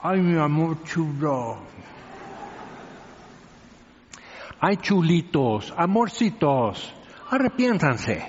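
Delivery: slow, syrupy, mock tender coaxing; mock sugary sweetness. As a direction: coo it like sweet talk, lightly mocking. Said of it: "tienes que decir".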